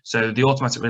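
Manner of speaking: ordinary talk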